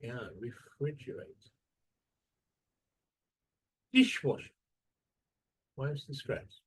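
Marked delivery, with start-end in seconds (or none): 0.4-1.5 refrigerate with FRIDGE as the strong part; stress on second syllable. Shown as a demonstration. 3.9-4.5 Dishwasher with a loud DISH; Dishwasher, strong start and weak ending; stress on first syllable. Shown as a demonstration.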